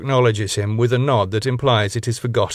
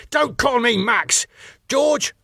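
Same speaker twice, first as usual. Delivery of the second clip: higher, hammed-up shout without much more volume; mock shout; 13 semitones higher, slower than usual. A narrator's reading. Owner snaps at Tom then calls the doorman, mock shout. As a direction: bark the order high and pompous.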